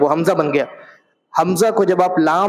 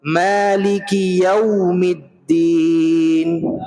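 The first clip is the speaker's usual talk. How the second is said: slow, drawn-out melodic tajweed chant; Quran recitation; much slower than usual. Shown as a demonstration. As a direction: intone each verse slowly for the class to repeat. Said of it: "ایک ایک آیت میں پڑھوں گا".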